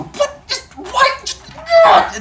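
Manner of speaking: very high, loud, laughing disbelief; teacher shows reaction to absurd behavior, high incredulous shout. Retold or imitated. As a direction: shriek it in total bafflement. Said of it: "makes me go".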